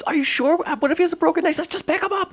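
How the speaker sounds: high, anxious back-and-forth; anxious voices. Retold or imitated. Friends arguing over moving the injured man, high and anxious. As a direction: fret over moving him. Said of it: "He goes".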